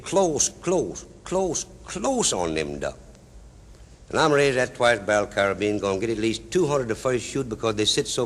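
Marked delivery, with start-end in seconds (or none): 0.0-3.0 each word stretched out slowly; suspenseful creeping. Acted. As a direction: draw out every step of the sneaking approach. Creeping up on the ducks in tale.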